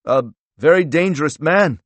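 high, loud incredulous echo; scoffing echo. A narrator's reading. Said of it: "Strobel replied with a laugh".

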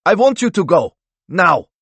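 high, loud dismissal; indignant, forceful demand to leave. A narrator's reading. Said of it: "raising his voice".

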